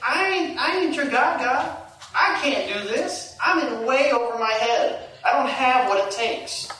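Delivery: a hint of a frightened farmer; Gideon's doubt. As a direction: voice the hero who feels unfit. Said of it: "He was thinking".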